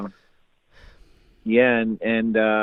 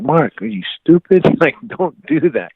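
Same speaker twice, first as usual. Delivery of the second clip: higher, incredulous dad's telling-off; scolding dad; 7 semitones higher, 4 dB louder than usual. Retold or imitated. Dad to son over his odd behaviour, scolding.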